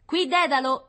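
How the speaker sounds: high, loud radio call; loudspeaker voice. A narrator's reading. Scout interrupting the experts over the loudspeaker, high and loud. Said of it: "riprese l'altoparlante interrompendo la conversazione".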